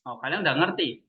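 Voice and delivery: ordinary talk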